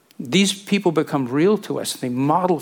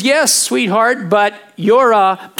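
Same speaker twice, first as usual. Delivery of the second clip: gentle parent; 6 semitones higher, 5 dB louder, slower than usual. Retold or imitated. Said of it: "And your answer is".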